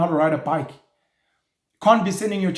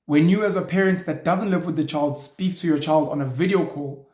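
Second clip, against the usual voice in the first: ordinary talk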